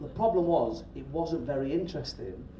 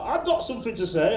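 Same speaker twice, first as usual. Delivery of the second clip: higher, eager little inner voice; jokey brain voice; 5 semitones higher, 3 dB louder than usual. Acted. Jokey bit of brain interrupting, higher pushy voice. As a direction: butt in, pitched up. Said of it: "the jokey bit's like".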